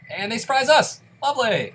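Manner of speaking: loud, high, sarcastic yelp at being ambushed; sarcastic outcry. Real, not acted. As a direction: shout it out, then a dry sarcastic "Lovely". And they surprise us!.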